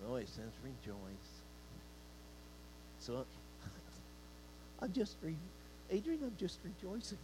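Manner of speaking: soft, lifeless "rejoice" with no energy; imitating a half-hearted worshipper; mock limp rejoicing. Shown as a demonstration. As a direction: mumble it like a bored churchgoer. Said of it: "put some emphasis into this".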